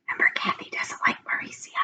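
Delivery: conspiratorial hush; whispered aside. A narrator's reading. Aside about Kathy whispered.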